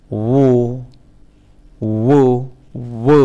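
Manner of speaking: উ said with lips pushed round. Shown as a demonstration. Teacher showing students how lips round for উ, deliberate. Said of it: "ঠোঁট গুল করে।".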